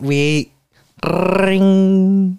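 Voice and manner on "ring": long, rolled, higher-pitched phone trill; imitated phone ring. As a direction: trill it like an old telephone. Imitating a phone for role play.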